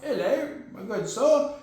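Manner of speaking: mock posh British accent, slow with a laugh; a laughing, slow stab at upper-class British. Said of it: "I don't think he's that kind of British".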